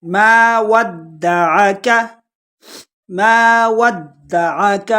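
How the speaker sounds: ما ودعک very slow, with a doubled, jolted د; jolted dal. Shown as a demonstration. Teacher showing the jolt on dal, slow recitation. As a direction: stretch ما and hit the د of ودعک hard. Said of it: "دال کو جھٹکے کے ساتھ پڑھیں گے".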